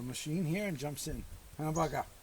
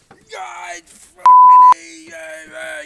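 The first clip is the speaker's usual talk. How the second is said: high strained mock shout, stretched out slow; cartoonish high-pitched yelling at his wife; imitating angry yelling; 22 dB louder, much slower than usual. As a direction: ham up a furious husband swearing. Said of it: "yelling at his wife and swearing".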